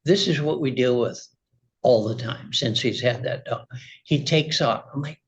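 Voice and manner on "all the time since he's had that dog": ordinary talk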